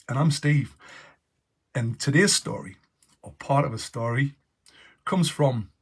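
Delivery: lively, bright storybook read-aloud; animated storytime voice; story narration. Acted. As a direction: read it like a children's story host. Reads his story aloud, announcer style.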